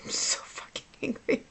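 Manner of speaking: anger pushed down into a whisper. Real, not acted. Woman confessing how she felt after leaving the clinic, angry whisper. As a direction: whisper it with no voice at all. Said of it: "I'm angry".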